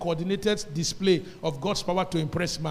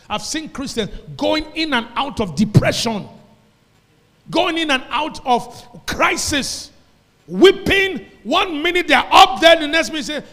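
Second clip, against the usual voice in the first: higher and louder until shouting; rising sermon shout; 7 semitones higher, 10 dB louder than usual. Real, not acted. Preacher describing up-and-down Christians, climbing to a shout. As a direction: build it up to a cry.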